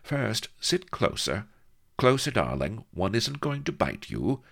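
high, coaxing seductress; lightly voluptuous invitation; voluptuous gurgle. A narrator's reading. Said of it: "she gurgled voluptuously".

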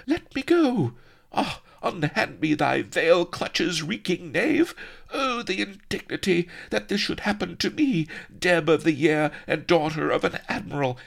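very high, shrieky outraged falsetto; indignant captive damsel. A narrator's reading. Struggling captive to Firebeard, shrill indignant protest. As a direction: wail in haughty, high-pitched indignation.

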